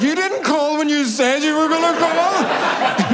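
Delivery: high, loud squeaky mock caller laughing; mock outraged caller. Squeaking mock outrage at a missed call. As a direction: play the phone person scolding him, high and loud.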